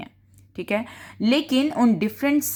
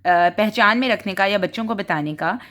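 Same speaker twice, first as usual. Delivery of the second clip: ordinary talk, nothing marked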